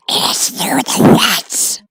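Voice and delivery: slow, rising to very high rasp; creepy monster giving directions. Acted. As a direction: wail the directions. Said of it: "creepy voice".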